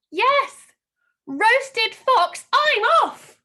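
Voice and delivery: high, loud triumphant exclamation; excited. Acted. Teacher reveals the answer to the class, excited and loud. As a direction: burst out with it.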